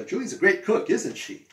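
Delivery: "isn't she?" falls at the end; falling tag. Shown as a demonstration. Teacher models the falling tag on "isn't she?". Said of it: "This goes down".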